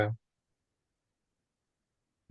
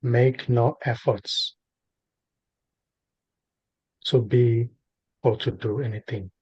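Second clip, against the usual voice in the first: spaced-out phrases with long silences between; guided meditation. Real, not acted. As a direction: guide a listener gently into stillness, unhurried.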